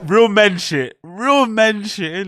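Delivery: loud, slow hype call; hyped shout. Real, not acted. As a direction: bellow it like a proud fan. Praising the clip loudly.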